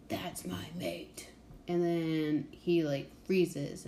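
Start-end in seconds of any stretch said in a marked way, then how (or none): 0.1-1.3 slow, breathy whisper of a possessive beast; inner bear whisper. Retold or imitated. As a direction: hush it like a secret claim. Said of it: "the bear inside of him is like".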